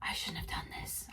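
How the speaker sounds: whispered in fear; frightened whisper. Retold or imitated. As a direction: whisper it, clinging to someone. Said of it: "Holding on to another facilitator and saying".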